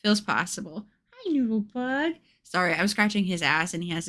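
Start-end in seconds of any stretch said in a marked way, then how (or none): 1.1-2.1 higher, cooing pet voice. Real, not acted. To her cat Noodle, a cooing baby-talk greeting. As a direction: coo at the cat.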